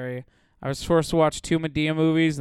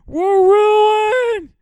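very high, loud despairing scream; hammed-up shout; 13 dB louder than usual. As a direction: scream it to the heavens.

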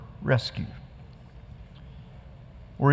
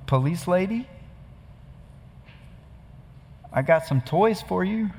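lightly voiced small child, higher; a hint of a little kid showing off his toys; little boy; 6 semitones higher, 4 dB quieter than usual. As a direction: mimic an excited four-year-old on the phone. Retelling the four-year-old boy's call.

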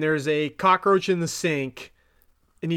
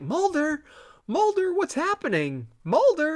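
high-pitched mock concern; concerned calling; 10 semitones higher than usual. Scully calling Mulder over the phone, concerned. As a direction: call out for someone who has stopped answering.

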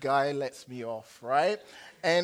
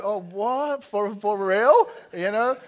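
mock-shocked, higher and louder; surprised; 6 semitones higher, 10 dB louder than usual. Real, not acted. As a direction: act amazed at the crowd's answer. Reacting to audience pronunciation.

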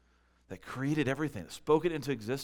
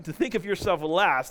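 ordinary talk, nothing marked

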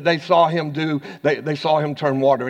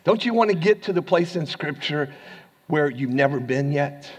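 ordinary delivery

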